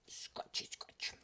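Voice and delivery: whispered, barely audible; a quick silly whisper. Pretend. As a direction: breathe the words out unvoiced.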